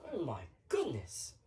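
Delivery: very quiet, low exclamation; low, hushed dismay; stunned muttering. Acted. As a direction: say it softly to yourself. Left alone after customer storms out.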